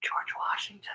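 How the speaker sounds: unvoiced, hushed reveal of the name; whisper. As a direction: breathe the name like a secret.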